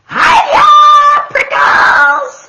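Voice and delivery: very high, loud cheeky hello; shouted greeting. Acted. Jackrabbit shouts a teasing hello at Hank, high and loud. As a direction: holler it in a high voice. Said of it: "she shouted".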